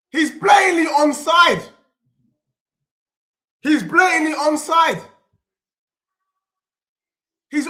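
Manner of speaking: real indignant shouting; outraged. Real, not acted. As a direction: insist he is onside. VAR ruling against his team.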